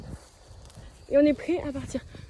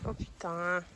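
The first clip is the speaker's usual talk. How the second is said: ordinary talk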